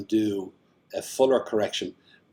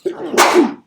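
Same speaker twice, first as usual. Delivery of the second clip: loud sudden sneeze; sneezing; 11 dB louder than usual. Real, not acted. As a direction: let out a sharp, real sneeze. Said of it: "Sorry, I'm gonna sneeze".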